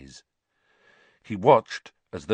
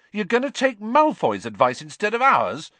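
much higher and louder, outraged; furious; 8 dB louder than usual. A narrator's reading. Ron furious that Harry listens to Malfoy. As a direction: explode at him in disbelief. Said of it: "said Ron furiously".

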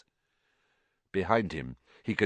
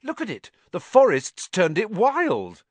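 high, excited marvelling; gleeful high-pitched wonder; delighted; 11 semitones higher, 7 dB louder than usual. A narrator's reading. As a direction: build to a loud, thrilled exclamation. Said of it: "said Ron delightedly".

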